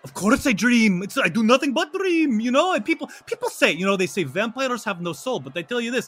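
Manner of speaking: animated, soulful vampire; earnest vampire. Acted. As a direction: plead your case to friends with feeling. Ivan talks of dreams and soul.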